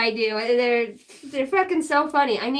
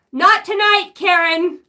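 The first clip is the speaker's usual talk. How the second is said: mock brush-off; 4 semitones higher, 5 dB louder than usual. Mock telling off Karen.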